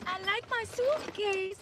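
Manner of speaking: put-on high, slow little-kid Slovenian accent; Barron Trump. Quoting Trump's son from the viral clip, high accented child voice. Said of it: "That's what he says".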